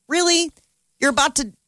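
high, disbelieving really; incredulous. Retold or imitated. Host disbelieving Meghan's claim, high incredulous. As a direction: scoff in disbelief.

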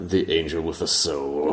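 low, slow angel impression; forced deep tone, stretched out slowly. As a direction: imitate a grave angel, drawing out the words. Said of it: "imitating my, my forced, uh, deep voice".

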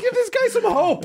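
very high, squeaking through laughter; words swallowed by real laughter; helpless laughing. Real, not acted. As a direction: plead while cracking up. Cracking up while teasing.

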